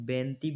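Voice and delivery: बेंती in two syllables, nasal first vowel; variant pronunciation. Shown as a demonstration. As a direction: shorten it to बेंती, ben-tee with dental t. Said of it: "भी बोलते हैं". (बेंती, दो अक्षरों में अनुनासिक उच्चारण)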